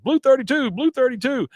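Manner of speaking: high, loud quarterback call; calling the play. Retold or imitated. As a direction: bark the snap count up high.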